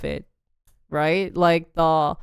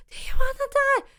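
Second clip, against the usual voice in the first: mock scream. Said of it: "he's, like, screaming".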